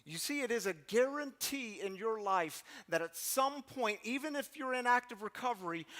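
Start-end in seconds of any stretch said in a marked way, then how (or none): none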